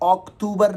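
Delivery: अक्टूबर as ok-TOO-bar; slow mistaken अक्टूबर, ok-TOO-bar; wrong pronunciation. Shown as a demonstration. Teacher ruling out the mistake, अक्टूबर as ok-TOO-bar. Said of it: "नहीं है".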